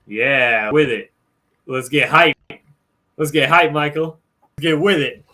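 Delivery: loud, pumped-up cheering; hyped up. Real, not acted. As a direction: get the crowd hyped. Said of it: "hyping up the stream".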